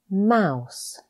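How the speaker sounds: normal talking voice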